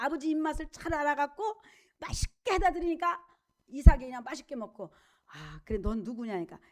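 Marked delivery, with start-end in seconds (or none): 5.3-6.6 put-on low, soft old father's voice; a blind old father asking, lower and softer; old Isaac asking. Preacher voicing blind Isaac asking his son, low and soft.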